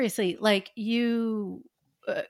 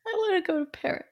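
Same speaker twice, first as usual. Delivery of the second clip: mock crying; 4 semitones higher than usual. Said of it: "It does sound like she's crying".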